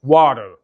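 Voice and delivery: "water" drawn out as "wa-rer", flapped T and sounded R; slow American "water" with full final R; American pronunciation. Shown as a demonstration. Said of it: "زي مثلًا كلمه water".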